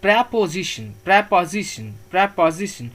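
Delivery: "preposition" as "prap-a-zi-shun", each one separate; model pronunciation. Shown as a demonstration. Teacher drills the class on pronouncing it, model pronunciation. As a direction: drill "preposition" with open A and short O sounds. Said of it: "इसको pronounce करेंगे हम".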